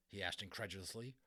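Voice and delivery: ordinary delivery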